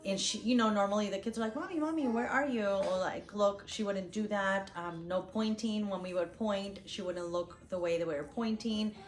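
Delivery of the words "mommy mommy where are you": put-on high-pitched little kid calling out; imitated calling child. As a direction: play a kid looking for a parent. Voicing kids calling for a parent.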